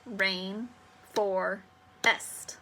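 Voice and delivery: each syllable separate with a pause between; broken into syllables. Shown as a demonstration. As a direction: break rainforest into "Rain", "for", "est". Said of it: "break it down".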